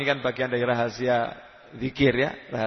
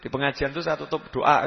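ordinary talk

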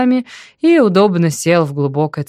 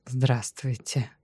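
тихо и медленно, с опозданием; скажи тихо, будто спохватился; запоздалый ответ; 4 semitones lower, 9 dB quieter, slower than usual. A narrator's reading. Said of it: "запоздало ответил Даниил". (тихо, запоздало)

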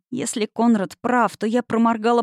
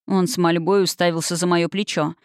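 ordinary delivery, nothing marked

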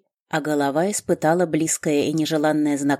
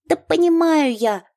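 high irritated gnome snap; gnome snapping back; 13 semitones higher, 6 dB louder than usual. A narrator's reading. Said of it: "огрызнулась гнома".

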